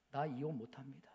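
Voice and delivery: whispered through tears; tearful whisper to the pastor. Retold or imitated. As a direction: breathe the words like a secret vow. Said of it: "막 울면서".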